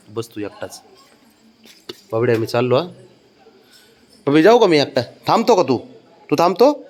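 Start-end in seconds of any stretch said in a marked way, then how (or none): 4.3-6.8 teasing. Real, not acted. Teasing child, laughing.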